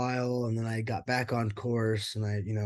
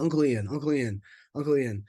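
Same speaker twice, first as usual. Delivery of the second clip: put-on higher, louder childlike calling; a child tugging for attention, voiced higher; kid calling out; 4 semitones higher, 4 dB louder than usual. Kid calling for him at bedtime.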